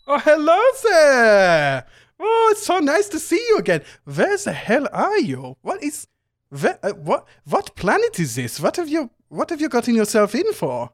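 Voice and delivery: very high, loud, gushing flamboyant voice; Eriken's high gushing greeting. Acted. GM voicing Eriken greeting Titus in a high flamboyant gush. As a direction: greet the old friend with high delighted gushing.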